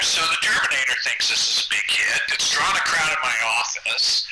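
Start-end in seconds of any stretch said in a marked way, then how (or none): none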